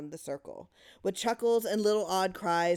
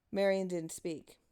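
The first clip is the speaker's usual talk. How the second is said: ordinary delivery, nothing marked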